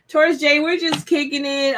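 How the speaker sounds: ordinary talk, nothing marked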